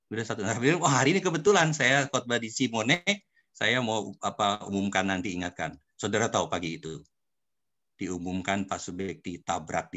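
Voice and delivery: ordinary delivery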